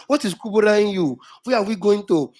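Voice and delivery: normal talking voice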